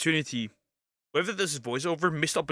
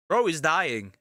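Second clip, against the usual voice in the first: ordinary talk, nothing marked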